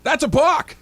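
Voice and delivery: high, loud outraged call; yelled call. Retold or imitated. Fan watching pitcher balk, loud yell. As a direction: shout the call at the umpire. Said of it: "goes like this".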